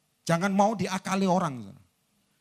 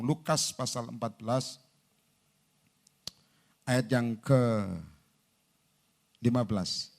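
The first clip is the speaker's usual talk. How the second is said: slow announcement; 6 semitones lower, slower than usual. Real, not acted. Preacher looking up the verse, slow and subdued. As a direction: stretch it out while finding the verse.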